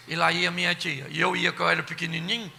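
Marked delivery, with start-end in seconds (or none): none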